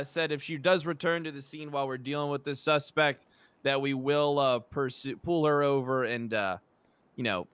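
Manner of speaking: ordinary talk, nothing marked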